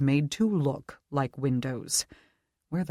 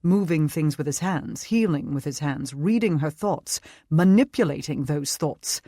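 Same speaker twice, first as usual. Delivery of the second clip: put-on British accent; 3 dB louder than usual. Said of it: "British accent".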